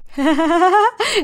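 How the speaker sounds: high giddy laugh. A narrator's reading. Layla climbs out of the wreck alive, laughing. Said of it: "وقالت بضحك".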